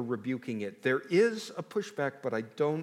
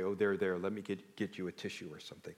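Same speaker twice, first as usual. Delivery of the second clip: mock soothing; 9 semitones lower, 6 dB quieter than usual. Mock God comforting Jeremiah.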